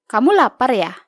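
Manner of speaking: ordinary delivery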